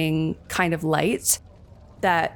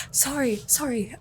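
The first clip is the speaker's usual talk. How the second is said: small, high, soft apology; startled little voice, apologising softly; talking to herself; 6 semitones higher, 4 dB quieter than usual. Acted. Dahlia jumping back, apologising in a high, small voice. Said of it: "She's fully talking to herself".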